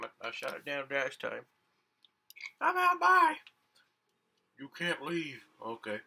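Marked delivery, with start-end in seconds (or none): 2.6-3.4 high-pitched, louder defiant character. Acted. Game character refusing the host's challenge, high and defiant. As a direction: squeak out a stubborn refusal.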